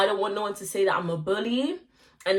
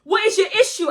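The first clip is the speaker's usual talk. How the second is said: sharp, higher demand; confrontational; 9 semitones higher, 4 dB louder than usual. Retold or imitated. Retelling the party row, mock angry challenge. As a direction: challenge her, irritated. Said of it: "I'm like".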